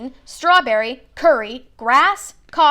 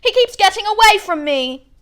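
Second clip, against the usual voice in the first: wailing; 10 semitones higher, 7 dB louder than usual. Acted. Boy wails to the boys about his lost toad, loud and high. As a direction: wail it out, heartbroken. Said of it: "he wailed".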